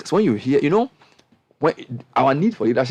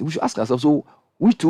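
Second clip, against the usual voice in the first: usual speaking voice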